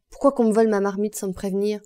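loud, fast indignant question; fast loud grievance; angry accusation. Acted. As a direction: fire the accusation quickly. Honorine accuses someone of theft.

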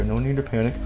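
usual speaking voice

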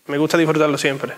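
ordinary delivery

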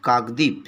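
"কাকদ্বীপ" said as kaak-dip, with no b sound; careful teacher's "কাকদ্বীপ", closed kaak then dip; pronunciation demo. Shown as a demonstration. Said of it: "উচ্চারণ করছি এইভাবে".

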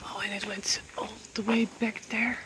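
hushed, awed whisper. Real, not acted. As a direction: whisper so as not to disturb. Whispering inside the temple.

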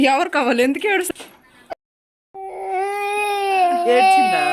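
mother calling out to her daughter, high and loud. Real, not acted. Mother greets daughter back from school.

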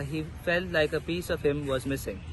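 plain reading voice

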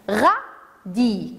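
"radis" split into ra, di; syllable by syllable. Shown as a demonstration. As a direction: say "radis" in two beats, silent s. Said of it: "Combien y a-t-il de syllabes".

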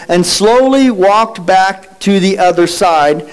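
ordinary talk

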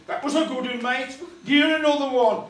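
put-on a loud, rowdy kid yelling encouragement; mock loud cheer. Rough lad at the seaside egging on the puppets, loud.

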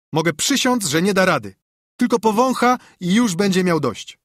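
put-on high, emphatic boyish scorn; cocky boy Joe. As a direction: swear he'd never manage it. (zadziorny chłopiec, wysoko i z przekonaniem)

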